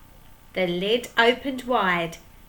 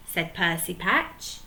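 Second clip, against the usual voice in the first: ordinary delivery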